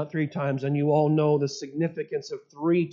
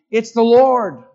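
louder, sudden cry of recognition; excited exclamation; 3 semitones higher, 9 dB louder than usual. Acted. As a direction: call it out to a friend in the boat. John recognises Jesus from the boat.

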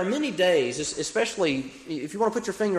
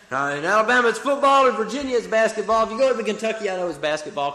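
higher and louder, building fervor; preaching loud; 6 semitones higher, 6 dB louder, faster than usual. Real, not acted. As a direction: call it out to the congregation. Rallying crowd about football states.

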